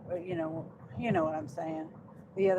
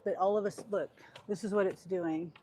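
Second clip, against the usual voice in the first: normal talking voice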